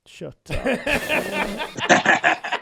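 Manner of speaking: playful laughing protest; laughing shut up. Real, not acted. Teased host laughing at his co-host's joke. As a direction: brush off the tease mid-laugh.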